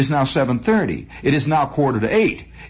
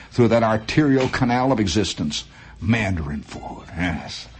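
ad read; 4 semitones lower than usual. Acted. Reads a restaurant advertisement.

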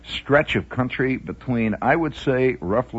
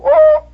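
very high shrill toot; tiny train whistle; 13 dB louder than usual. Retold or imitated. Host mocking small European train whistles, high toot. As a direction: squeak it like a little European whistle. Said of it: "little whistles that go".

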